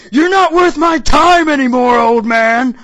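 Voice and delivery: hammed-up anime villain yell; villain's triumphant shout. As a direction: hurl the insult at the old man in triumph. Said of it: "It was like".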